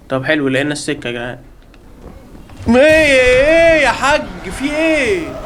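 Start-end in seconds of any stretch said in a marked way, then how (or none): 2.7-5.4 high, slow alarmed "what, what"; real panic, stretched out; startled drawn-out cry. Real, not acted. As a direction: yelp as the enemy appears. Chased in game, panics.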